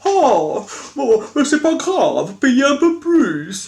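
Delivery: high, slow, sugary mock British seller; imitating the ever-smiling British seller. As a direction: smile it out like a cheerful clerk. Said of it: "Toujours britannique, très souriant".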